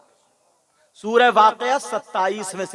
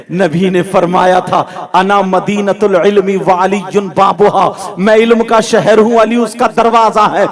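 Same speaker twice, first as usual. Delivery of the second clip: raised, ringing sermon delivery; full-force preaching; 7 semitones higher, 11 dB louder than usual. Acted. Preacher retelling the husband's questions to the congregation, raised declamation. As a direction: declaim each phrase insistently to a crowd.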